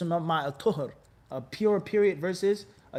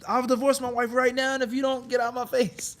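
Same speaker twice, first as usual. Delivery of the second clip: angry outburst; 5 semitones higher than usual. Acted. Husband angrily blurting out a divorce in mock fury. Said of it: "somebody made him so angry".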